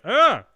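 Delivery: short, high, loud mock gasp; imitated yelp. Host imitates co-host hearing about five-mile hike, mock yelp. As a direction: mimic a shocked little cry. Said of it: "she was like".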